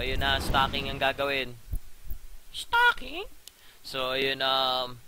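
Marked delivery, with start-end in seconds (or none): 2.5-3.3 surprised squeak. Real, not acted. Caster questions the stacking choice.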